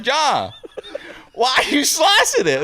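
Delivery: high-pitched comic Texan questioning; Texas accent. Acted. Coach giving John a lesson, high Texas accent. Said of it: "I started slipping into this accent".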